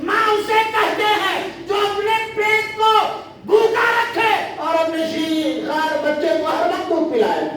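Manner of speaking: shouted fervent sermon on a mother's sacrifice; preaching at full force. Acted. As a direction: thunder each line to the congregation.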